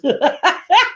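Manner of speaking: real high laugh. Real, not acted. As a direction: burst out laughing. Amused by the chat.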